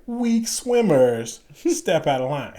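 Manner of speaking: high, loud, drawn-out order; mock military call, slow and pointed; instructor call-out. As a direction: stretch each word like an instructor addressing the line. Imitates instructor calling out the line.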